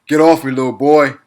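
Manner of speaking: loud mock taunt; retold taunt. Quoting player's boast after a bucket, loud taunt. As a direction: bark it out as a retold put-down.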